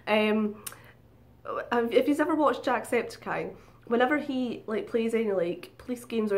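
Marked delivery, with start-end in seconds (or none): none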